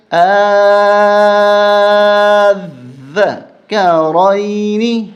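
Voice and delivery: آذکرین with the first آ held very long and loud; long drawn madd. Shown as a demonstration. Teacher demonstrates the long madd, held loud. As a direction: stretch the آ of آذکرین five counts. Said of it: "زیادہ کھینچیں گے پانچ الف تک".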